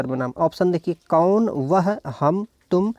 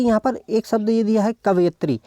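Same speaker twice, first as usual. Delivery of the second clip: normal talking voice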